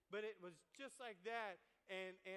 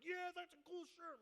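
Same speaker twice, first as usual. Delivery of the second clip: higher, pleased agreement; proud reply; 8 semitones higher, 4 dB louder than usual. Retold or imitated. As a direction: show off a little. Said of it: "And I'm like".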